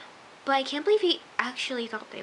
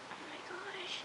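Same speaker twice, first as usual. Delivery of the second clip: barely voiced, higher aside; whispered dismay; 9 semitones higher, 17 dB quieter than usual. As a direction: whisper it under the breath. Her whispered reaction to show.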